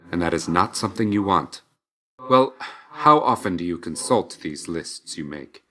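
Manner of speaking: usual speaking voice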